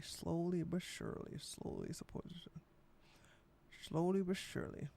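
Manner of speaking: hushed creepy whisper; eerie whisper. Pretend. Ominous repetition of a warning.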